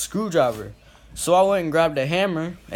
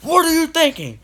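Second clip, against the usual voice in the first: mock angry father, much higher and louder; scolding dad; 13 semitones higher, 6 dB louder than usual. Father scolding his hurt son, imitated anger. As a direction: bark the question as the mad dad.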